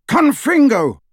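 high, loud blasting yell; yelled spell. A narrator's reading. Harry destroying the falling sidecar, loud yell. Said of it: "and yelled".

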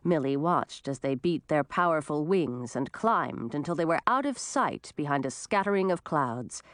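usual speaking voice

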